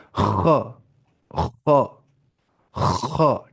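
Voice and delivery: "خا" opening on a long throat scrape; scraping kha. Shown as a demonstration. Teacher showing the scraping sound needed, raspy kha demo. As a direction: rasp the start of each "خا" where throat meets mouth. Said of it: "تھوڑی خراش کی آواز لانی پڑتی ہے".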